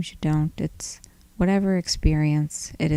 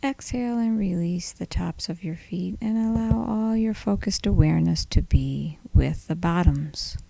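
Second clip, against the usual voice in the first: soft, unhurried body-scan voice with long pauses; guided meditation; 4 dB quieter than usual. Acted. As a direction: gently lead them through the body, leaving space between phrases.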